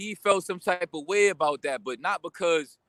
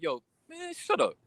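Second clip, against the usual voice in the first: much higher, dismissive put-on voice; high, mocking shut-up; mock brush-off; 11 semitones higher, 4 dB quieter than usual. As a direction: flick him off in a squeaky tone. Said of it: "I'm like".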